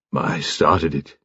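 embarrassed, subdued gentleman; halting commander. A narrator's reading. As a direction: own up haltingly, low and slow. Commander haltingly confesses his gift.

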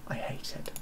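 whispered confiding aside. Real, not acted. Confessing to viewers he hates the music, whispered. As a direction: drop to a secret whisper.

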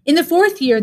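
usual speaking voice

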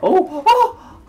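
high, loud startled cries; surprised yelp. Real, not acted. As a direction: yelp in sudden shock. Sudden game event.

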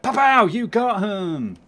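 slow, loud mock-heroic kid line; cool kid catchphrase. Gamer imitating the kid's cool catchphrase, hammed up. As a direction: ham up a cool action catchphrase. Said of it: "comes out with a cool catchphrase like".

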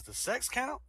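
high-pitched, curious patient; acted hopeful question. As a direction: ask it high, as a joke patient.

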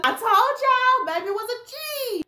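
very high, excited brag; gleeful. Real, not acted. Storyteller bragging to viewers about the wife, very high. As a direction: crow about it, thrilled.

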